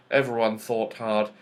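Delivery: plain reading voice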